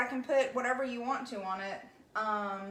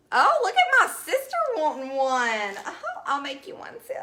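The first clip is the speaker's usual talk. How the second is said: much louder, delighted exclamation; excited; 4 semitones higher, 9 dB louder than usual. Real, not acted. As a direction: call out happily at your sister. Sister joins the stream.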